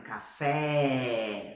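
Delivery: CAFÉ with a long, open Portuguese é; open vowel. Shown as a demonstration. Teacher showing the mistake learners make in Spain, open vowel. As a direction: stretch the wide é of CAFÉ. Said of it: "não existe som aberto".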